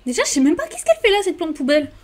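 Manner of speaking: plus aigu, plus fort, très vite; s'agace et débite à toute vitesse; râlerie rapide. Real, not acted. (râle vite, voix plus haute et plus forte)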